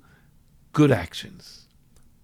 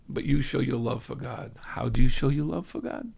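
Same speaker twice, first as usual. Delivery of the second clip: ordinary delivery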